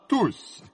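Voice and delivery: "tous" said high and set apart; stress on the word. Acted. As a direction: lean on "tous" with a sharp jump in pitch. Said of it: "en appuyant sur ce mot". (« tous » appuyé, nettement plus aigu)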